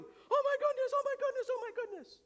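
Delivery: high panicked shriek; shouting wife. Retold or imitated. Wife yelling across the room at sleeping husband, high mock shriek. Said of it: "Especially shouting".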